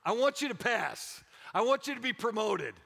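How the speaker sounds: ordinary talk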